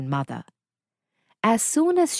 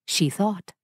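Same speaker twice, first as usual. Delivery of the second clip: plain reading voice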